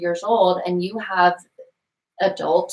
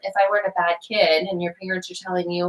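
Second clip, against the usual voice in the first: ordinary talk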